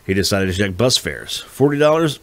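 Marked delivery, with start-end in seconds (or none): 1.4-2.2 pitched up in pleased surprise; surprised. A narrator's reading. Danny reacting to the bus fare, surprised. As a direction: react to a cheap fare, brightening.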